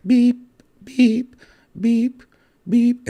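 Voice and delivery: high steady electronic beeps; machine beeping. Retold or imitated. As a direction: imitate a heart monitor beep. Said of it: "It's like".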